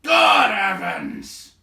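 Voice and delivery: loud, high, slow shouted oath; loud growling outburst; growled exclamation. Acted. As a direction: boom it out in outrage. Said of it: "growled Baron".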